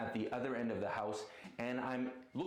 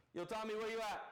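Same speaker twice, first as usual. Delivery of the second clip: yelling across the room; 8 semitones higher than usual. Real, not acted. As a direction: shout it across the house. Calling to Tommy in another room.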